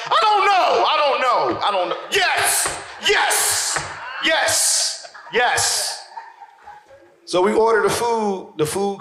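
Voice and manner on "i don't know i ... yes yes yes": high-pitched frantic outburst; hammed-up panicky cries; stoned freak-out. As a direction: blurt it out as if too high to cope. High man's panicked answers, acted.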